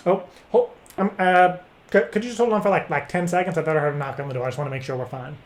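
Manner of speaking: ordinary delivery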